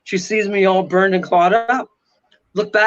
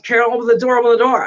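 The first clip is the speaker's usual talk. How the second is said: raised, urgent mock yell; retold screaming; 5 semitones higher, 3 dB louder, faster than usual. Trapped man calls to companion outside, imitated urgent yell. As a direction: act out a moderate, higher-pitched scream for help. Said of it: "I'm screaming".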